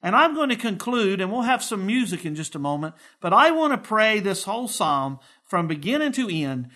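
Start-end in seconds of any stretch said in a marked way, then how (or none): none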